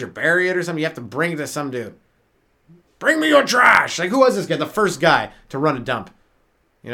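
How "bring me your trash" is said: high, loud summons for trash; hoarder calling. Pretend. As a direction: call out eagerly, pitched up.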